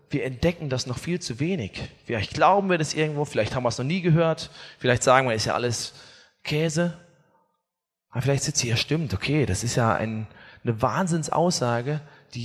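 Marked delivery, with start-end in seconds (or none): none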